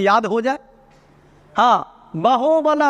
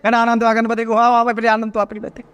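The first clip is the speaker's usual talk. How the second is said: rattled off quickly on a level pitch; hurried reading; faster than usual. Shown as a demonstration. Teacher showing students what rushed reading sounds like, fast monotone gabble. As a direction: race through the Sanskrit without pausing. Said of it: "जल्दी जल्दी पढ़ना। जैसे".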